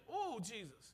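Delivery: usual speaking voice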